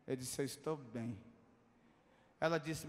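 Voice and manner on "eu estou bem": retold low, soft answer; subdued reply. Tearful passenger answering the attendant, low and subdued. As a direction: answer low and quiet, holding emotion back.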